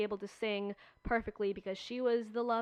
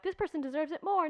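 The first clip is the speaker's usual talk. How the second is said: higher, insistent imitated protest; a hint of a heated argument, higher; arguing; 6 semitones higher than usual. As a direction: mimic upset castmates pleading their case. Upset cast members arguing.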